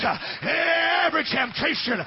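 high, loud shouted preaching over music; full-force preaching. Acted. Pastor urging the congregation to rise, shouted preaching. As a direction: preach it out to the whole church, climbing.

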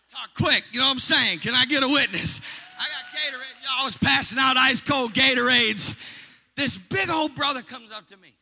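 loud, high, fired-up preacher's call; full-force preaching. Acted. Preacher rallying the congregation with a loud call. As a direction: preach it out to the whole room.

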